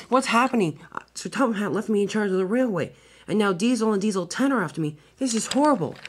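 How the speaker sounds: high, fretful engine voice; scared. A narrator's reading. Thomas pursued by two diesels, scared high voice. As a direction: wail it in dismay, pitched well up. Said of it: "Thomas was now very scared".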